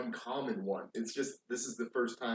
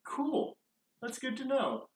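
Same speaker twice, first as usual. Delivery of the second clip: much higher, breezy customer voice; high mock caller; 14 semitones higher than usual. Closer mocking a late caller, high and breezy. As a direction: chirp it like a clueless caller. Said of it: "And they're like".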